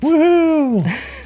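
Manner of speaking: high, loud whoop of joy; excited cheer. Real, not acted. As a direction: cheer like you just won. Podcast finally starts recording.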